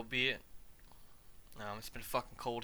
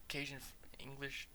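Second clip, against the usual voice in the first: whisper; 3 semitones higher, 11 dB quieter than usual. Real, not acted. Muttering while looking it up.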